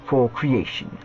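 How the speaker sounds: hammed-up British accent, pitch swooping high; mock British letter-reading voice; quasi-British accent. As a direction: read it like a caricatured Brit. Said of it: "comments in a quasi-British accent".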